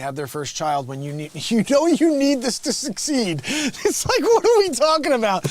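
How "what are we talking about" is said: ordinary delivery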